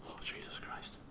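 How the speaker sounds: breathless whispered oath; real whispered panic. Real, not acted. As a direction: mutter it in unvoiced dread. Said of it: "player panics under his breath".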